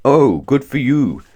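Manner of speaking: slow, rumbly Father Christmas; rumbling voice. Acted. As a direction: rumble it warmly. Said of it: "the voice rumbled".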